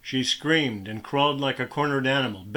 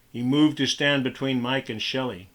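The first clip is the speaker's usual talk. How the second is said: usual speaking voice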